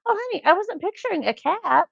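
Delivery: high mock gasp, no scream; shocked lady. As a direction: imitate a startled old lady recoiling. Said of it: "the lady screamed".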